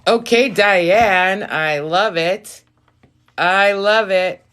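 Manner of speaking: loud, drawn-out delight. Real, not acted. Reader thrilled that Diane booked, delighted. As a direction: cheer her on slowly and loudly.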